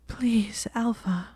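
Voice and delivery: high breathy whimpered plea; half whimpering. Acted. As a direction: whimper it out, barely voiced. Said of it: "she half whimpered".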